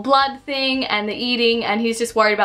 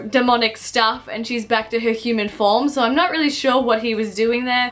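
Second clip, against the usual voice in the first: ordinary delivery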